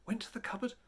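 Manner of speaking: ordinary delivery, nothing marked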